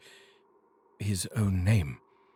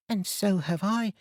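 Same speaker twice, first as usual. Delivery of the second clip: put-on higher eager boy crying out; 11 semitones higher than usual. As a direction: jump in eagerly as the young son. Peter cries out.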